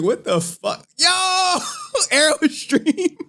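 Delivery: high, shouted disbelief; startled outburst. Real, not acted. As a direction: cry out in high-pitched astonishment. Startled reaction on the stream.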